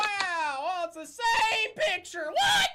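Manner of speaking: very high, loud mock shouting; mock shock. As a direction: shriek in fake disbelief. Mock shock over the photo.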